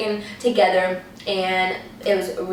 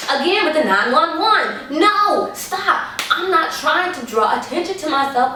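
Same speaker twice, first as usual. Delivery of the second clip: exasperated; 8 semitones higher than usual. Retold or imitated. Speaker objecting to calling nine one one, high exasperated protest.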